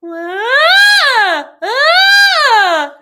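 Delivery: very high whining wail; whiny high sound. Shown as a demonstration. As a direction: whine like a helium balloon. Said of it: "the whine kind of a sound".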